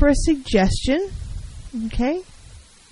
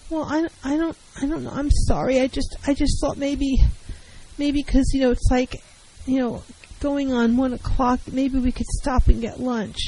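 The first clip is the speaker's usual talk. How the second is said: higher and softer, apologetic and halting; whining; 4 semitones higher, 4 dB quieter than usual. Shown as a demonstration. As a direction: whine timidly, afraid to ask for lunch. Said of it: "all this whining".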